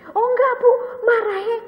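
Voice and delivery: high, crying, voice breaking; tearful reply. Retold or imitated. Grown woman telling her counselor about her father, crying. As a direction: let the words crack into tears.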